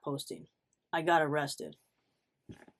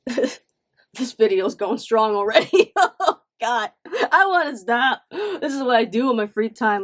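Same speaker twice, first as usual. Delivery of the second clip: loud, slow words through laughter; laughing while talking; 2 semitones higher, 7 dB louder than usual. Real, not acted. Giggling at her own video while talking. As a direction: try to talk but keep cracking up.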